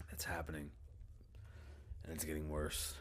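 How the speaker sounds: soft unvoiced whisper. Real, not acted. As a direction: whisper it like a secret. Whispered confession.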